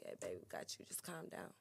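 low, soft, almost whispered reassurance; calm him down gently under the breath; soothing hush. Shown as a demonstration. Man to his woman, reassuring: soft hushed calm. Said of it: "It's all about your delivery".

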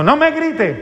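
mucho más agudo, sin gritar de verdad; un padre regañón imitado, con la voz disparada hacia arriba; imitando papá gritón. Acted. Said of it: "es que usted grita más". (grito de regaño fingido)

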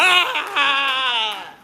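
long loud high wail; hammed-up cry of overwhelm; mock scream. Acting out two chaotic months.

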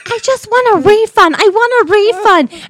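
high, loud demanding whine; Karen voice. Retold or imitated. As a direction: whine it like an entitled customer. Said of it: "And she's like".

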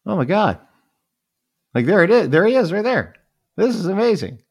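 high, loud, breathless awe at seeing a celebrity; starstruck excitement. Retold or imitated. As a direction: gush like a star-struck fan. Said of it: "I'm like".

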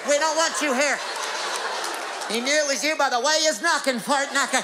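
high hammy voice. Acted. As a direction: play it like a country kid shouting. Acted door scene, high voice.

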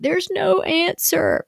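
put-on high, slow whining child; whiny kid. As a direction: drag it out like a kid giving up. Kids whining after a no.

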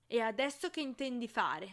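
loud angry demand; thundering, beside herself. A narrator's reading. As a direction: boom it out in rage. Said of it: "tuonò fuori di sé".

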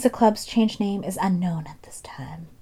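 hushed, eerie whisper; spooky whisper. Acted. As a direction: whisper it like a ghost tale. Spooky narration ending.

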